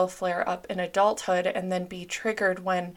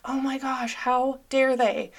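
higher, drawn-out indignant gasp of offense; mock outrage; 5 semitones higher, slower than usual. Reacting to a mean comment with hammed-up outrage. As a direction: play up being insulted, slowly. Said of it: "You're like".

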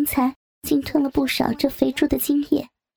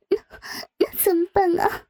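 high, hesitant worry; nervous; 5 semitones higher than usual. A narrator's reading. Narrator asks what to do now, nervous. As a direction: stammer slightly, pitched up and anxious. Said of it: "我紧张地说道".

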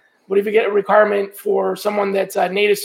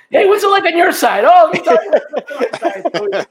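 high, loud mock-eager chat; animated retold banter; 8 semitones higher, 5 dB louder than usual. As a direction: act out two buddies eagerly trading stories. Swapping war stories with best friend.

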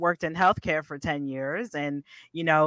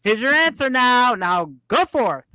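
booming voice from above; 7 semitones higher, 4 dB louder than usual. Retold or imitated. Purpose speaking to her.

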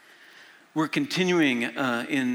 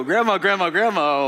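put-on loud, raised child's call; imitated grandson calling; 6 semitones higher, 8 dB louder than usual. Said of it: "when you hear a grandson go".

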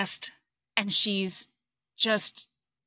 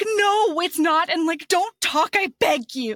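higher and louder, desperate begging; exasperated pleading; 7 semitones higher, 4 dB louder than usual. Retold or imitated. Hero begging his bored wife to stop talking, high exasperated plea.

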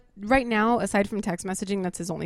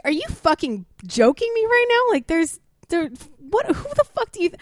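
much higher, louder incredulous rant; outraged reaction; 9 semitones higher, 4 dB louder than usual. Retold or imitated. Reacting to the ex's message, incredulous and furious. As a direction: let the disbelief boil over. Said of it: "I read that and I was like".

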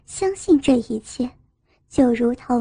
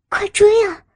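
loud high-pitched urging; exasperated cry, pitched up; urgent, flustered; 7 semitones higher, 5 dB louder than usual. A narrator's reading. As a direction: call out sharply to send him running after her. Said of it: "气急地说道".

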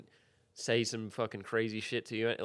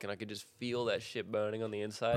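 normal talking voice

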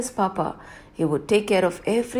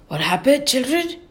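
plain reading voice

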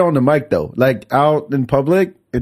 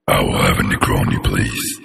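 deep creepy voice. Shown as a demonstration. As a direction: rumble it out low. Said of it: "What would you like to drink?".

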